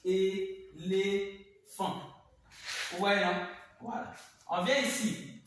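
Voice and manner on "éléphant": "Éléphant" very slow, a gap after each syllable. Shown as a demonstration. Teacher showing pupils the split, "Éléphant" in separate syllables. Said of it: "si on découpe même".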